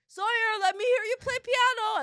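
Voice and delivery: hammed-up eager father calling; mock husband voice.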